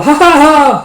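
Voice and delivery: high, loud mock gleeful laugh; happy laugh. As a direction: cackle happily like a wild child. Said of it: "grabbing it happily with a".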